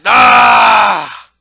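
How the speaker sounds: loud impatient shouting from the stands; bloodthirsty crowd yell. Retold or imitated. Spectator who paid a coin yelling at fighters, loud impatient shout. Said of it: "a member in the crowd like".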